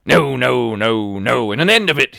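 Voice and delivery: furious loud refusal; loud cry. A narrator's reading. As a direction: shout the refusal through the wall. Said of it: "he cried once".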